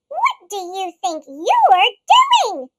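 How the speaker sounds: loud, slow outraged demand; indignant cry. Acted. Grouch scolding Monster, loud and outraged. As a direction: scold it hard. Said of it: "cried Grouch".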